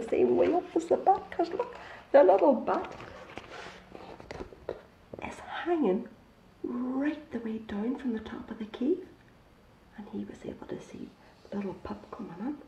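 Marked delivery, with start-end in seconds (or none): 5.2-12.6 quick hushed whisper. A narrator's reading. Storyteller whispering about the bat to the children.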